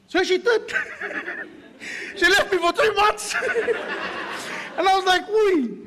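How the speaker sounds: high, loud, laughing through the words; cracking up while telling it; real laughter breaking up a sheepish confession. Real, not acted. Laughing retelling wife leaving him.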